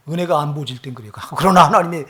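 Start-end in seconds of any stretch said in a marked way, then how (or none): 1.4-2.1 loud, high, forceful sermon exhortation; impassioned preaching. Real, not acted. Preacher urging the congregation to bow before God, impassioned. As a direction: preach it at full force, voice raised.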